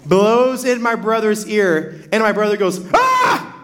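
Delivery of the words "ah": sudden high yelp, much higher than his voice; a startled kid's high cry. Retold or imitated. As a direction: yelp like someone just blew in your ear. Brother startled in the car.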